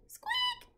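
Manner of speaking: tiny squeak way up high; high mouse squeak. Acted. Peanut the mouse answering the children, a high squeak.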